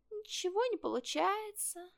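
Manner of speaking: high, small fox voice; fox cub admitting defeat. A narrator's reading. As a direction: confess failure in a thin high voice. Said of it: "признался даже лисёнок". (лисёнок признаётся тонким голоском)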